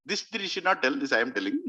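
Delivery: normal talking voice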